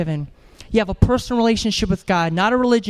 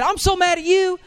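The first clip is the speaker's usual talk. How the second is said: mock angry girlfriend; 9 semitones higher than usual. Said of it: "she said".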